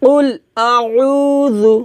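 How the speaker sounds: "আউযু" bem lento, com যু leve entre língua e dentes; dh fino. Shown as a demonstration. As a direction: alongue o উ e afine o যু de "আউযু". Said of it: "জু পাতলা".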